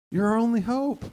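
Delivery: plead, lifting "hope" high. Shown as a demonstration.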